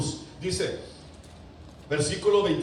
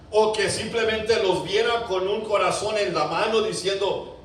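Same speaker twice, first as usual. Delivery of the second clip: ordinary delivery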